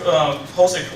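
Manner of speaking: ordinary talk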